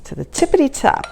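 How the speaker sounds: tippity lilting up to a rising top; sing-song tippity top. Shown as a demonstration. Cook showing viewers a childhood saying, playful sing-song. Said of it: "That's what me and my sisters used to say".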